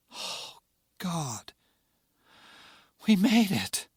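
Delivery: a breathless, disbelieving gasp of relief; breathless, barely believing it. Acted. Rebecca, breathless after the crash landing, in relief. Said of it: "Rebecca said breathlessly".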